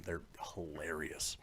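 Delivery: soft amused whisper. Real, not acted. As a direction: breathe it out quietly. Aside about funny trailers.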